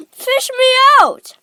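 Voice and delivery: high, loud plea for rescue; yelling. A narrator's reading. Augustus begging to be fished out, yelling. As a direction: yell desperately from the water. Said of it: "he yelled".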